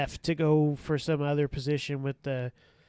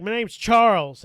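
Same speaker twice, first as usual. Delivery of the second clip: loud, slow, higher-pitched indignant correction; little man syndrome; 6 semitones higher, 11 dB louder than usual. Retold or imitated. Short man corrects officer on his name, indignant. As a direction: imitate a small man puffing himself up. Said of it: "had little man syndrome".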